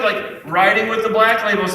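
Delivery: ordinary delivery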